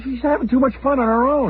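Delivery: high anxious whine; worried whine. Retold or imitated. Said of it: "I said".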